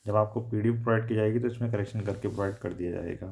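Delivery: usual speaking voice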